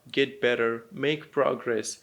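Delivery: usual speaking voice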